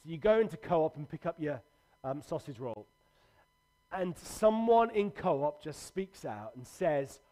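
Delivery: ordinary talk, nothing marked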